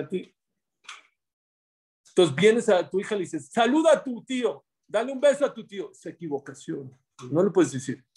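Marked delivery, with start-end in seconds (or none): 3.5-5.9 coaxing parent. Retold or imitated. Parent instructs daughter.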